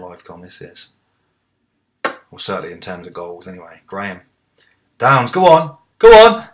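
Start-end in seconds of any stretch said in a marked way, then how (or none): 5.0-6.5 very loud high shout at the screen; yelling encouragement. Real, not acted. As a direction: roar the player on. Urging player near goal.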